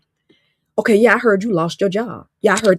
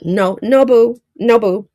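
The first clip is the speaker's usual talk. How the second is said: playful no; 5 semitones higher, 4 dB louder, slower than usual. Real, not acted. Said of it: "rejecting the quoted answer".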